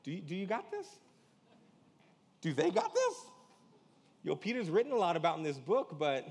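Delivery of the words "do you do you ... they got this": mocking high question.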